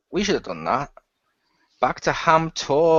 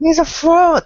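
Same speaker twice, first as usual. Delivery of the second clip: loud verdict; 14 semitones higher, 8 dB louder, slower than usual. Real, not acted. Blurting a joke verdict.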